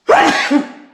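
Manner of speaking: sharp dusty cough; coughing on dust. Real, not acted. Sneeze warning, dust.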